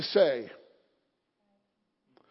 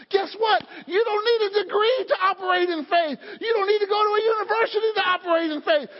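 rising preaching shout; 8 semitones higher than usual. Real, not acted. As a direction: preach it out, pushing harder each line. Preacher building to full force.

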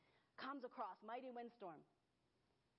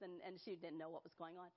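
ordinary talk, nothing marked

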